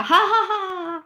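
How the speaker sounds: high-pitched burst of acted laughter; wild laughter. A narrator's reading. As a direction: break into a mad, high cackle. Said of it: "顿时响起一阵狂笑".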